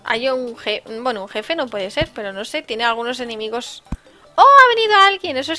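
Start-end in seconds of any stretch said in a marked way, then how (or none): 4.3-5.4 high, loud startled cry; surprised. Real, not acted. Streamer noticing a new arrival, surprised shout. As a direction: caught off guard.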